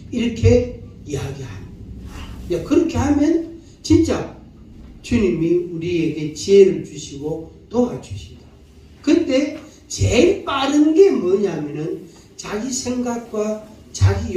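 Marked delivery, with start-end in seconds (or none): none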